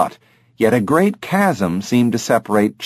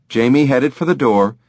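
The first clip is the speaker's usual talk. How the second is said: plain reading voice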